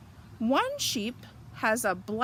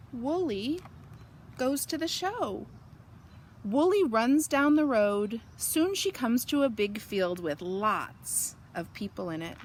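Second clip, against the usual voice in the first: usual speaking voice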